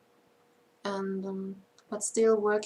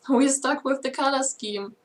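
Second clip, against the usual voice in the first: laughing; 5 semitones higher, 6 dB louder than usual. Real, not acted. Laughing while admitting it.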